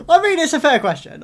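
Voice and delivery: loud, high words through laughter; real laughing. Real, not acted. Viewer amused by the father question, laughing as they speak. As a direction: say it while cracking up. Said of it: "I don't know why I'm laughing".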